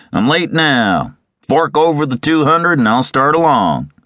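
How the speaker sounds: a touch of creditor's impatience; impatient moneylender. A narrator's reading. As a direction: press him for the cash. Moneylender demands payment.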